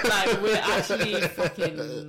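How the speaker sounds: loud, laughing, slowed. Real, not acted. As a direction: laugh through the words.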